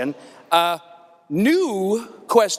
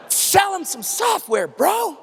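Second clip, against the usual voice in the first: hype shout; 9 semitones higher than usual. Acted.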